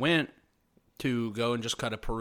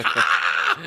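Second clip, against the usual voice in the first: loud, sudden barking laugh burst; Pete Holmes laugh; 5 semitones lower, 10 dB louder than usual. Retold or imitated. Podcaster greeting an adoring fan, loud laugh burst. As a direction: blast out one big delighted laugh. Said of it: "he did his loud Pete Holmes laugh".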